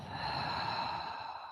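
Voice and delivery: long audible exhale through the mouth; slow deep breaths. Shown as a demonstration. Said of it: "And exhale through the mouth".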